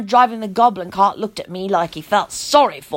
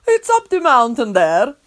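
calm. Acted. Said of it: "the man replied calmly".

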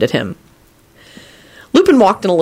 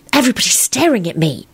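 high, horrified aside; 7 semitones higher than usual. A narrator's reading. Elizabeth to Lupin as students stare, horrified high voice. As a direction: say it aghast, pitched up. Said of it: "said in a horrified voice".

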